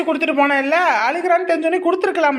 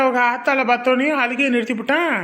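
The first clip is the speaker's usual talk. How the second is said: ordinary talk, nothing marked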